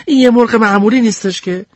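put-on high pleading kid voice; insist, hands up, wait wait; defending myself. Sneeze defends his chicken to the group, high pleading. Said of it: "از خودم دفاع کردم".

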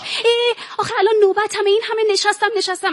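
high-pitched pleading complaint; petulant, pleading objection; whining protest. Acted. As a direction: whine about it in a high voice. Acted whining protest over waiting.

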